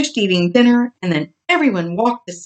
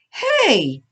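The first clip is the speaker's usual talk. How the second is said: puzzled; 9 semitones higher than usual. A narrator's reading. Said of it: "she asked".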